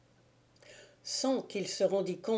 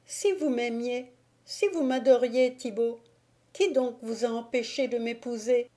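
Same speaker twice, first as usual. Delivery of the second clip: put-on higher, timid young woman; Agnolette's voice; 5 semitones higher than usual. Agnolette answers Thibaut, higher and timid. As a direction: speak as the gentle frightened girl.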